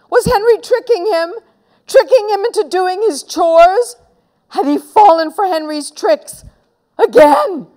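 high, rising, alarmed questions; incredulous, pitch climbing; dawning alarm. A narrator's reading. Peter's dawning horror at the trick.

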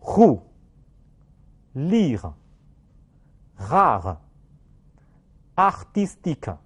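"roux，lire，rare，artistique" very slowly, with uvular r; "roux，lire，rare，artistique" as a French r drill; read-after-me words. Shown as a demonstration. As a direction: model each French word, long pauses between. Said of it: "请大家跟我读".

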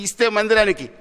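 usual speaking voice

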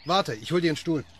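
erfreut und laut gerufen; laut rufend. Acted. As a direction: ruft Judd über die Wiese zu. Ruft Judd über die Wiese zu. (freudig laut rufend)

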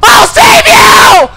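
high, loud frantic shouting; panicking gamer. Real, not acted. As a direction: scream in full chase panic. Chase, shouting to teammate.

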